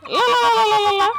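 high shouted "la la la"; loud la-la-la. Pretend. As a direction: playfully belt out a string of la-las. Playful shouted la-la after boast.

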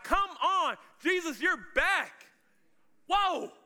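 high, loud, stretched-out amazement; thrilled disciple crying out; excited. Acted. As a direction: shout it high in joyful disbelief. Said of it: "and they're excited".

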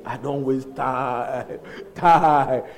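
slow, drawn-out "time"; retold dismay. Preacher echoing Hagin on wasting time, slow dismay. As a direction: stretch the words out in mock dismay.

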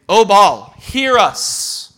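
loud pleading cry, rising high; calling out. Retold or imitated. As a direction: cry out to a distant god. Priests calling on their god.

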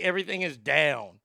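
ordinary talk

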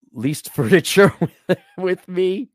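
slow, breaking into laughter; laughing while reading. Real, not acted. Host reading a viewer's furniture request, laughing. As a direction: crack up halfway through the question.

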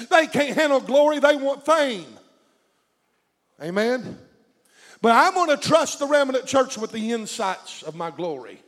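ordinary talk, nothing marked

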